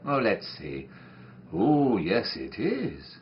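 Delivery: usual speaking voice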